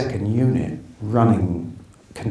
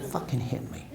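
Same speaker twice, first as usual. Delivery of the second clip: Joe Pesci impression; 3 semitones higher, 9 dB quieter than usual. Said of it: "Do you wanna hear Joe Pesci?".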